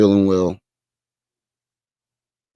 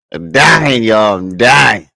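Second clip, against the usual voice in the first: louder, hammed-up dying wail; dramatic; 2 semitones higher, 6 dB louder, much slower than usual. Real, not acted. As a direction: overdo it, sick and dramatic. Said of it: "My wife say I'm dramatic".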